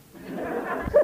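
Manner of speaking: short burst of laughter. Real, not acted. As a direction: break into a brief laugh. Cười về chuyện gặp Phật. (bật cười)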